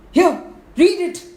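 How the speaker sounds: high, loud urgent cry; panicked Tragopan. Acted. Tragopan bringing frightful news to the elephant, high panicked urgency. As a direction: thrust the news at a friend in alarm.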